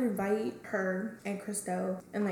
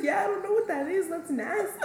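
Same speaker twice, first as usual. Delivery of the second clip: mock innocent cousin; 8 semitones higher than usual. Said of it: "And she's like".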